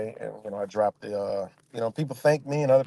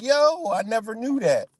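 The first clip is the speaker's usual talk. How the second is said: high-pitched retold astonishment; amazed; 10 semitones higher than usual. Streamer quoting people who hit him up, amazed. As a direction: imitate someone stunned by new information.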